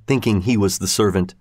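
ordinary delivery

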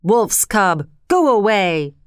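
lightly acted hostile priest; understated storybook shout to drive him off; priest's shout. A narrator's reading. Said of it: "shouted the priest".

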